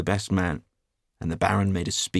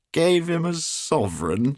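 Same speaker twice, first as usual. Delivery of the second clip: high, slow disbelieving cry; incredulous shout; 9 semitones higher, 5 dB louder, slower than usual. A narrator's reading. Trevor shouts back at Hughie in disbelief. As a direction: toss the words back in amazement. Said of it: "shouted Trevor".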